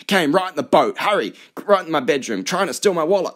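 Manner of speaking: loud, high American outrage; worked-up American yacht owner; American accent. Acted. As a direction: shout for help in an American accent. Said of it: "said in English with an American accent".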